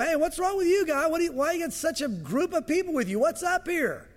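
high, brash mock challenge; gruff challenge. Danites turn on Micah, brash challenge. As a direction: toss it back like a tough guy. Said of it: "they turn and go".

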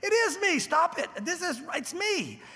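the healed man begging the doubting neighbors to believe him; insistent protest. Retold or imitated. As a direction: wave your arms and insist it's really you. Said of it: "He goes".

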